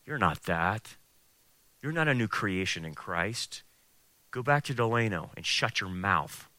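low, hushed devil's voice; tempter's challenge. Acted. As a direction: belittle softly, close to the ear. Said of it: "he's trying to challenge my biblical identity".

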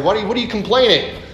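fast, high mock complaint; hammed-up irritation; exasperated. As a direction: tease the whiners. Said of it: "It's like".

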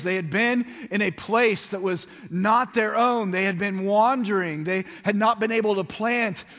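high, loud, driving preacher voice; full-force preaching. Acted. Pastor rousing his congregation, loud and high. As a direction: preach it out to the whole room.

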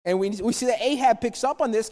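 ordinary talk, nothing marked

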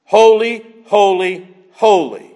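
Holy, holy, holy, each Holy set apart and punched; threefold stress. Shown as a demonstration. Preacher stressing the threefold holy to the congregation. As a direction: hit each holy as its own beat. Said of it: "in this threefold manner".

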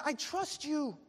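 high-pitched avowal called upward; crying out. Acted. Climber pledging trust to the voice, crying out. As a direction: cry it up to the voice. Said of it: "cried the man".

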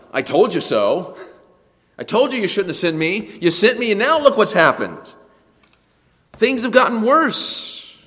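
high, loud, bitter reproach, rising on told; accusing complaint. Retold or imitated. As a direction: throw the blame back at God. Moses accusing God.

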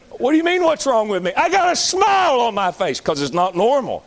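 high, rising outrage; indignant exclaim. Real, not acted. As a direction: throw the question back in disbelief. Preacher indignant at the question.

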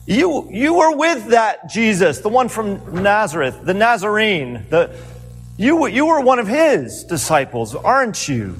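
put-on accusing servant girl. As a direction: point at him and accuse.

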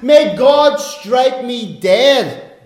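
loud, slow, high hammed-up curse; mock oath. Peter swearing to the crowd, a loud slow mock curse. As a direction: drag out each word like a furious oath. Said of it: "he called down curses upon himself".

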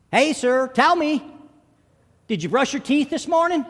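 put-on high, loud little boy piping up; eager boy. Boy asks seatmate Stan on the plane, high and loud. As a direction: call out a blunt question, high and loud.

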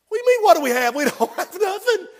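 high, disbelieving retort with a laugh; incredulous disciples. Retold or imitated. Disciples answering Jesus, high and incredulous with a laugh. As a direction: scoff in amazement, voice jumping up.

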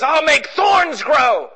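slow, booming shout as God; God decreeing it, slowly and loud. Acted. God speaks to fallen man, slow shout.

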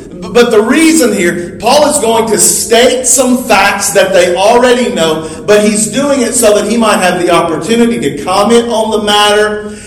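ordinary talk

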